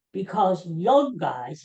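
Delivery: put-on "young" drawn out slowly and leaned on; slow down and hit "young"; quoting the surgeon, stretching "young" with a rise; stress on young. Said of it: "he emphasized the word young".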